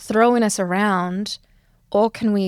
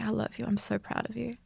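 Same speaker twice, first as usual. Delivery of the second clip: much softer, tender reassurance; 2 semitones higher, 10 dB quieter than usual. Shown as a demonstration. Teacher guides class to comfort inner child, tender. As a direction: say it softly to your inner child. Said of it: "wrap that one up and say".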